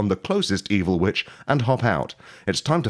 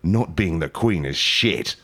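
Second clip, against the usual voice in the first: usual speaking voice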